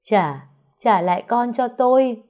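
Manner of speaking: high, loud, slow desperate scream. Acted. Mother screams after the car taking her daughter, desperate. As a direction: wail it after the car, stretched out. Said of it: "gào lên".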